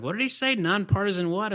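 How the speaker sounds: high-pitched incredulous questioning; retold bafflement. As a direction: replay a puzzled double take. Retelling own puzzled reaction.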